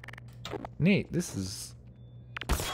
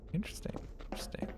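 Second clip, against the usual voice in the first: whisper; 7 semitones higher, 11 dB quieter, much faster than usual. Real, not acted. Whispered aside while exploring.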